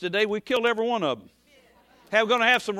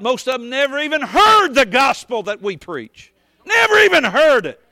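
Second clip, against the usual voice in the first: preaching shout; 3 semitones higher, 8 dB louder than usual. Real, not acted. Preacher rebuking ignorance of the gospel, shouting. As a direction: blast it out from the pulpit. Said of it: "I'm not yelling at you".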